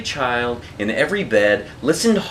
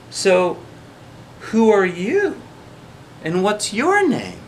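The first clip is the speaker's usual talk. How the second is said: put-on curious girl; 6 semitones higher than usual. A narrator's reading. Child asks the creature.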